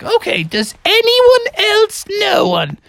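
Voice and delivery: high, impatient teacher goose; Mother Goose. Acted. Teacher moves on to the next pupil, high impatient goose. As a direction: call on the class in falsetto. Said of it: "She's like".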